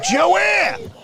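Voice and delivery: sharp high call across the room. Acted. Boss calls Joanne in the back, sharp high call. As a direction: shout her name up high.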